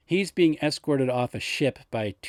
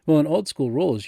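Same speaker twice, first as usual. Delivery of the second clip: usual speaking voice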